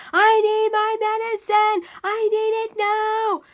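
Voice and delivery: loud, high, slow demand; imitated yelling. Imitating the screamer patient demanding medicine, yelling. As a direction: holler insistently, dragging out the words. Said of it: "he's just yelling and yelling".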